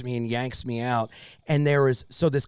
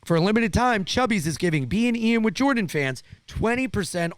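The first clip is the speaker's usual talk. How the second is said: upbeat sponsor read; lively promo delivery; 3 semitones higher, 3 dB louder than usual. Acted. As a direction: sell it like a podcast host reading an ad. Reads a sponsor ad.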